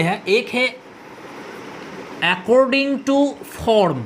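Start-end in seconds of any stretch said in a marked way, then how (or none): 2.3-4.0 very slow, word by word; slow dictation. Shown as a demonstration. Teacher dictating the term very slowly to the class. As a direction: spell it out slowly for students to write. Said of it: "इसका मतलब है गठन अनुसार".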